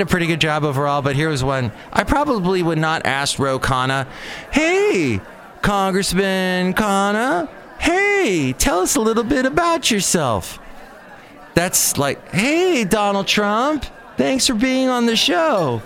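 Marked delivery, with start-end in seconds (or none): 4.5-10.6 high, drawn-out fake-friendly host voice; sing-song mock interviewer, much higher pitch; mock cheesy interviewer. Mocking a lame interview question. 12.3-15.8 sing-song mock interviewer, much higher pitch; mock cheesy interviewer. Mocking a lame interview question.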